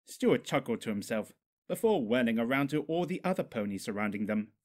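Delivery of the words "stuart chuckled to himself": plain reading voice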